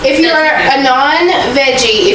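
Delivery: ordinary talk, nothing marked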